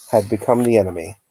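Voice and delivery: ordinary delivery, nothing marked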